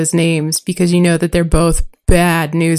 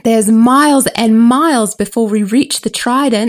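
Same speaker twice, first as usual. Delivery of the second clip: put-on higher, slow girl's voice; lightly voiced girl; 4 semitones higher, slower than usual. Girl telling the boys the trip is long, slowly. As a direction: drag out the bad news. Reads Arya's lines.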